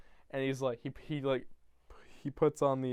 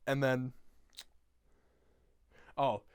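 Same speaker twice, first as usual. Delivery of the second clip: normal talking voice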